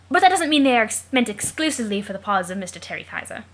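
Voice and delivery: hearty British explorer voice with loud emphatic bursts; put-on British accent. Jake writes to John about a rabbit, hearty British accent. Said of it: "the only reason he has that accent".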